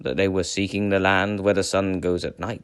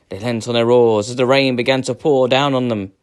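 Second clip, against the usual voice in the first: ordinary delivery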